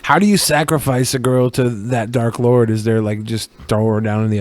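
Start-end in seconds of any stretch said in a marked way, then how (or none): none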